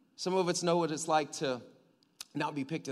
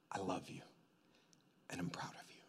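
low, soft fatherly whisper; tender whisper; 9 semitones lower, 16 dB quieter than usual. Acted. God as father telling his son he is proud, whispered.

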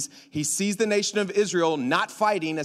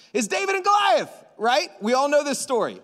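shouted high, triumphant; loud reveal; 7 semitones higher, 3 dB louder than usual. Real, not acted. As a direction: shout the title to the room. Loud announcement of story.

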